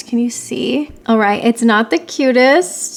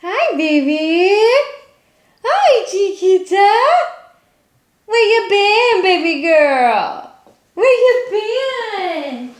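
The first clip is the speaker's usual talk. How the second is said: sing-song, stretched pet voice; pet greeting; 9 semitones higher, 4 dB louder, slower than usual. Real, not acted. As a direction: coo a doting hello to a pet. Greets pet on coming home.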